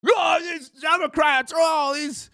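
high, slow mock outburst; mock angry self. Host parodying himself ranting, high slow mock outburst. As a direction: ham up a fake rant. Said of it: "Can you imagine good old [name] News?".

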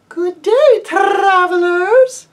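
high, loud, drawn-out sweet lady's greeting; lady calling sweetly to travelers on road; sweet birdsong voice. Acted. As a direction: call out sweetly like a songbird. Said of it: "she cried out in a voice as sweet as the sweetest bird song".

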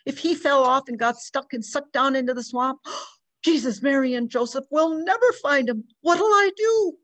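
mother pouring out fear for her lost son, rising to panic; distraught mother. Acted. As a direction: spill out the fear until it breaks at the top. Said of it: "poured the story out".

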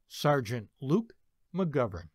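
usual speaking voice

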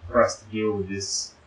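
usual speaking voice

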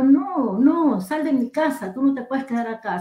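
mock-outraged protest in a retold quarrel; acted indignant refusal. Acted quarrel with the alien visitor.

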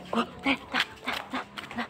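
breathy whisper urging the dog on. Real, not acted. Owner whispering to her dog to come along, whispered. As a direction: whisper to the dog to keep walking.

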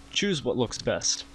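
ordinary talk, nothing marked

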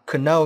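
"kno" with the silent k pronounced; wrong sounded K. Shown as a demonstration. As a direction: say "kno" as kuh-no. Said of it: "Chúng ta không đọc là". ("kno" đọc sai, bật âm k)

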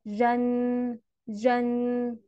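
"جن" on one level note, ending in a hummed n; audible final nun. Shown as a demonstration. Teacher shows how tanween is pronounced, final n ringing in "جن". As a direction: hold the final n of "جن" like a musical chime. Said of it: "ده نطقها".

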